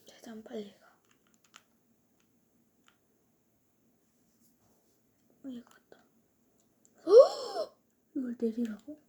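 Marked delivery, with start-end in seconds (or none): 0.0-6.0 whispering. Real, not acted. Whispering to self while playing. 7.1-7.8 loud high startled gasp; sharp gasp. Real, not acted. Sudden shock in game.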